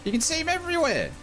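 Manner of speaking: high incredulous outburst; surprised disbelief. Real, not acted. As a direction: squeal it in disbelief. Astonished at viewer's comment.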